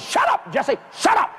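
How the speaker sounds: high, sharp shushing order; scolding mama. Retold or imitated. Mama silencing Jesse, scolding. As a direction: cut the boy off, pitched high.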